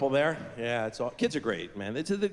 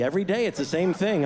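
ordinary talk

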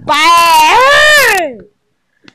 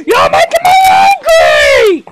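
angry shout; 8 semitones higher than usual. Real, not acted. Kid yelling at the game enemy, high angry shout. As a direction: let it burst out in rage.

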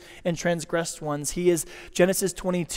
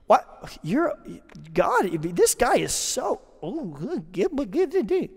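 mock disbelief; 3 semitones higher, slower than usual. Mock exasperated stammering.

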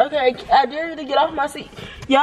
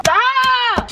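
high, drawn-out exasperated yell; fed-up yell; 10 semitones higher than usual. Real, not acted. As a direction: let it fly up high in protest. Being touched, fed up.

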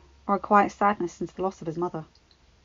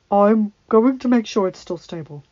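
vague; 8 dB louder than usual. Acted. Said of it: "he said vaguely".

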